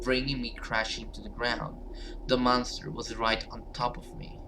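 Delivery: normal talking voice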